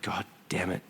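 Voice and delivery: hushed, breathy curse under her breath; retold scared whisper; panicked whisper. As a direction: whisper it so the girl won't hear. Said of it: "looks down and goes".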